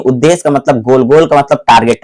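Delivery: ordinary talk, nothing marked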